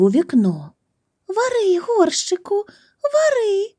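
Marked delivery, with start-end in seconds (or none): 1.3-3.7 put-on boy's squeaky high copy of the spell; singsong high-pitched chant, copied through a window; high mimicking voice. Boy peeping through the window, squeaking the spell in a high voice.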